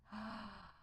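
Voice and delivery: audible intake of breath in wonder; delighted gasp. Real, not acted. Streamer seeing two harps, delighted gasp. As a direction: gasp at a lovely sight.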